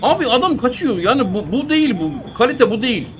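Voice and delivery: high, loud, exasperated rant; angry shouting. Real, not acted. Gamer ranting at the screen as the striker runs off, angry. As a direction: bark it at the screen in disbelief.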